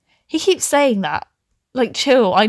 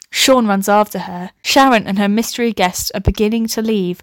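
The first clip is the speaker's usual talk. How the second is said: ordinary delivery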